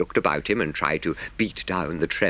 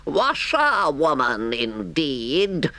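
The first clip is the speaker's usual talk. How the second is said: high, drawn-out indignant Toad; reckless shout; 8 semitones higher, much slower than usual. A narrator's reading. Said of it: "he shouted recklessly".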